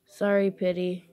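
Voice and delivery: ordinary delivery, nothing marked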